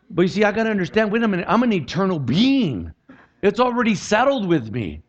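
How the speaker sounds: high, forceful preacher delivery; impassioned preaching. Acted. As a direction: preach it with urgency and punch.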